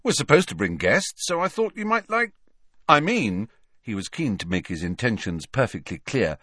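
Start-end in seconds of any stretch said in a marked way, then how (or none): 0.0-3.5 halting, with pitch jumping up; awkward explaining. Acted. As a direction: backpedal on an invitation, tripping into pauses. Harry awkwardly explains his invitation.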